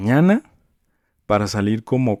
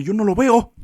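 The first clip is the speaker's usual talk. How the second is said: much higher, indignant complaint; high indignant customer; 12 semitones higher than usual. Retold or imitated. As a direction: sound outraged as the customer. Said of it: "agarra y despedaza y me dice". (clienta indignada con voz muy aguda)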